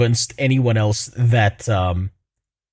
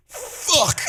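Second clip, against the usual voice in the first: put-on baby gasping. This baby's just like.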